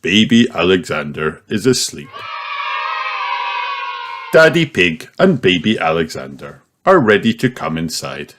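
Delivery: ordinary delivery, nothing marked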